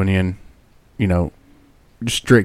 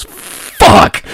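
shocked curse; 5 dB louder than usual. Retold or imitated. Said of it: "Susie looks down and goes".

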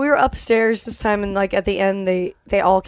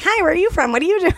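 higher-pitched, chatty questions to new acquaintances; eager greeting; 7 semitones higher, faster than usual. Retold or imitated. Met a million people, imitated eager greeting. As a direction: imitate yourself eagerly meeting everyone. Retelling greeting many strangers.

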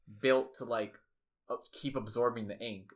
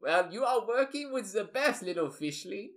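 put-on higher German-accented patter; Octo; 5 semitones higher than usual. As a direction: talk brightly high with a German accent. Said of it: "so Octo is kind of like".

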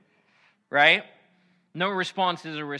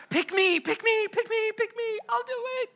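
put-on very high, pleading child voice; eager kid; 3 dB louder than usual. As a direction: squeal like a kid begging to be picked. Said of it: "It's like the kid on the playground".

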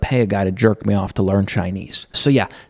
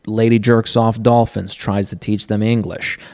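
ordinary delivery

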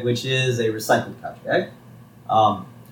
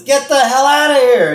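high, loud acted shout; angry dismissal; 13 semitones higher, 7 dB louder than usual. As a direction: shout them out of the house. Lenny throws Homer out.

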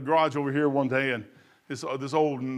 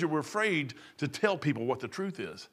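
usual speaking voice

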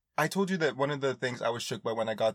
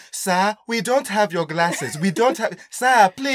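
higher, louder pleading, slow at first; pleading clerk; 6 semitones higher, 6 dB louder, slower than usual. Retold or imitated. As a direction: beg the angry guest to calm down. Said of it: "front desk people were like".

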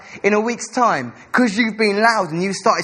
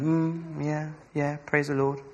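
softer, half-hearted mock praise; reserved mumble; 2 semitones lower, 7 dB quieter, slower than usual. Pastor mocking reserved English worshippers, soft half-hearted murmur. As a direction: mumble it like a shy churchgoer. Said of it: "we can be a little bit, like, reserved".

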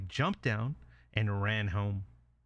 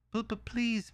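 high, stammering pig voice; pleading little pig; 12 semitones higher, slower than usual. Acted. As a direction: beg in a small high voice. Pig begs for bricks.

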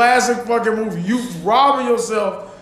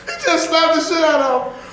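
ordinary talk, nothing marked

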